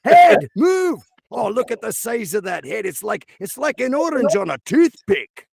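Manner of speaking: high, exasperated Scottish heckler; hammed-up Scottish mockery, opening on a high shout; Scottish accent. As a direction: taunt in a high Scottish brogue. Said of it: "I think I can do Scottish".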